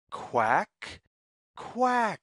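ordinary delivery, nothing marked

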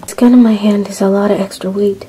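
normal talking voice

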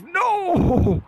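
high, loud drawn-out "no"; shouted no. Real, not acted. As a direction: cry out in dismay, stretching the word. Reacts to dying in game.

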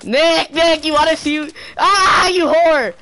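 high, loud frantic yelling; shouting. Real, not acted. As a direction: scream it mid-fight. Yelling during a game fight.

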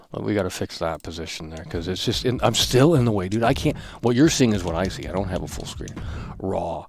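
ordinary delivery

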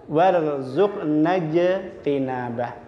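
"Warzuqna je tinabah" said slowly with a clear j, not hissed; slow correct jim. Shown as a demonstration. Teacher modelling the correct joined reading. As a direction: sound "je" with the middle of the tongue. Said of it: "Jadi jim bukan warzuq".

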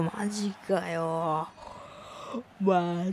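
speaking through a yawn; words stretched slowly by a yawn. Real, not acted. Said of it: "あくびがちょっと出ちゃった".

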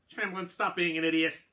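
high, loud scolding shout; sailing master shouting down the armed quartermaster; angry shout. Acted. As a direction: snap him out of it.

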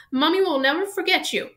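high, tearful and loud; sobbing. A narrator's reading. Hagrid tearfully promising the dragon he'll be remembered, high. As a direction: choke it out through tears. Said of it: "Hagrid sobbed".

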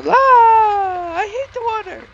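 slow shriek, very high, loud. Pretend. Shriek at water.

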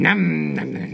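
playful nom nom eating noises; mock chewing sounds. Viewer mimicking a pony munching a sandwich. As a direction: mouth the chomping as a cartoon eater.

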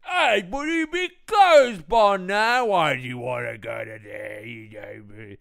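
mock Cockney gibberish, fading at the end; thick Cockney cabbie. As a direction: holler like an incomprehensible Cockney cab driver. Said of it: "this fucking cabbie".

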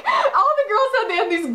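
high bursting laugh breaking into the words; real laughter. Real, not acted. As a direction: laugh helplessly through the start of it. Laughing at pimp outfit.

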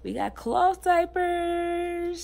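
high, slow sing-song; excited announcement. Real, not acted. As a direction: sing it out with delight.

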